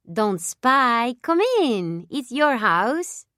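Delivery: put-on a young Italian dancer welcoming her guests; Italian accent. A narrator's reading. Dancer caught practicing invites the watchers in, Italian accent. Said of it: "with an accent no different from what an Italian might have".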